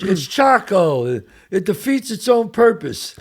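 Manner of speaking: high, loud mock complaint; slow, hammed-up movie rant; De Niro in Raging Bull. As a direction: imitate an exasperated outburst, stretched out. Continues the De Niro steak outburst.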